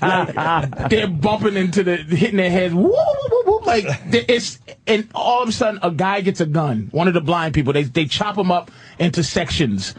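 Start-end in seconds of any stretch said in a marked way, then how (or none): 2.7-4.1 much higher, silly whooping; mock whoop noises. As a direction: squeal whoop whoop. Imitating blind people bumping.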